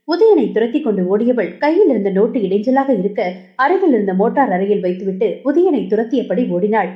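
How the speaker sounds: ordinary delivery, nothing marked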